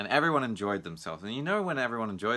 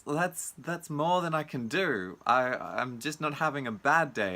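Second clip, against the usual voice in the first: mock overwhelmed; 6 semitones higher, 3 dB louder than usual.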